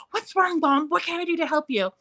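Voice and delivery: high-pitched imitation of a worried child; quick, high, solicitous kid voice; concerned little boy. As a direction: play a sweet son anxious to help. Little son worried for hurt mom.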